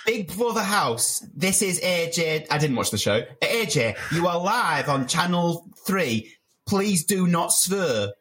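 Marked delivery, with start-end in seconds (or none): 0.0-2.5 slow, higher TV presenter voice; drawn-out live-show impression; AJ Odudu. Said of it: "your best A G Odudu impression". 3.4-8.1 slow, higher presenter impression; drawn-out reality-show host voice; AJ Odudu. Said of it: "your best A G Odudu impression".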